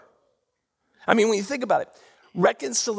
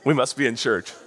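usual speaking voice

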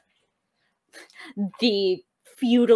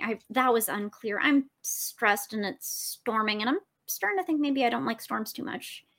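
usual speaking voice

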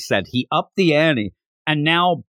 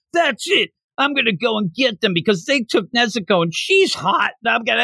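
higher, mock-furious Zenitsu; angry Zenitsu; 6 semitones higher than usual. Host voices Zenitsu storming off angry, higher mock fury. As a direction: fire up like a hothead storming off. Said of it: "And then he gets angry".

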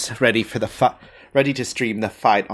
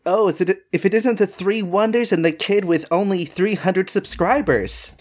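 mocking, higher-pitched streamer villain; high, theatrical villain greeting; taunting villain; 6 semitones higher than usual. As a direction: sneer at the heroes as their rival. Villain taunts the arriving heroes.

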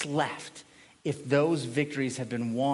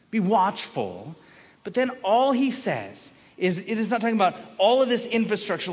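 ordinary delivery

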